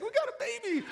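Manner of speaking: very high, loud imitation of thrilled children; ecstatic kids. Dad mimics his kids greeting the new baby, ecstatic. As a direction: squeal it like an overjoyed little kid. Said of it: "My kids were so ecstatic".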